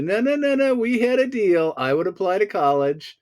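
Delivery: high, insistent objection; mock-indignant, high-pitched pleading; acted protest. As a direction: argue your loophole to your parents. Acts out protest to parents.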